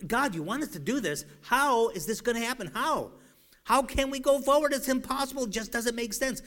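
high-pitched, baffled appeal to God; incredulous questioning. Acted. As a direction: protest that it defies all logic. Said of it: "He would be asking the logical question".

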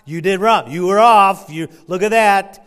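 loud, accusing rebuke; mock accusing scold; harsh scolding. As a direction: scold like a fault-finder. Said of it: "I don't like hearing somebody say".

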